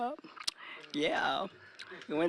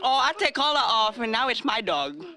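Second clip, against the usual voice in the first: high, loud panicked voice; mock shocked shout; 10 semitones higher, 7 dB louder than usual. Teller reenacts yelling at the lady over the dog. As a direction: yell it like the dog's in danger.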